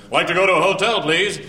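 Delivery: loud, high, over-clear English; loud tourist request. Retold or imitated. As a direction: talk up loudly to be understood. Tourist to taxi driver.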